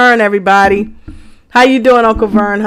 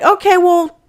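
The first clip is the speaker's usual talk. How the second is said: higher-pitched, retold brush-off; dismissive retort; 9 semitones higher than usual. Telling how you answered your school friend, higher-pitched brush-off. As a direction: act out your puzzled reply, pitched up. Retelling own reply to friend.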